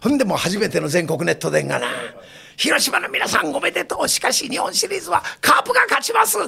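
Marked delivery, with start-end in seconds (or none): 2.6-6.4 high-pitched taunting announcement; a reporter taunting Carp fans in Hiroshima, shouting; provocative high shout. Retold or imitated. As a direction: goad the Hiroshima crowd.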